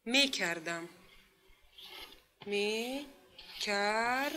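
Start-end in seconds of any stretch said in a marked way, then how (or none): 2.5-4.3 syllable by syllable. Shown as a demonstration. Same word split into syllables.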